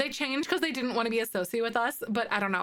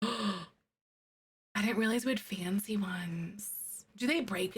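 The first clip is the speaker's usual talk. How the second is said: softer awed whisper; 2 semitones lower, 4 dB quieter than usual. Real, not acted. Streamer to herself after finding fancy horse items, whispering. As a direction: whisper it as a surprise find. Gasp, then hushed discovery.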